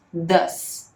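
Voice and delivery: Does with a short open "uh" vowel, D-uh-S; does said "dâs". Shown as a demonstration. Teacher showing students the English vowel in Does. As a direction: say Does like "dâs", a short dark "uh" and a final hissed S. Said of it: "A gente pronuncia o does como se fosse um D, um A com tio e um S".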